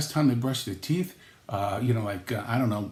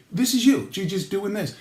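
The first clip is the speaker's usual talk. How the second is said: usual speaking voice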